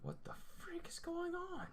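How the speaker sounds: high bewildered whisper. Real, not acted. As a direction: whisper it in disbelief. Whispers at his drawing.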